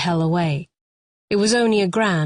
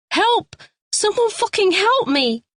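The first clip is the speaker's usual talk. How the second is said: panicked cry. A narrator's reading. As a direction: scream for rescue. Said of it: "she cried out in panic".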